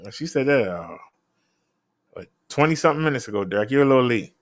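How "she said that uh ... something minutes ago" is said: usual speaking voice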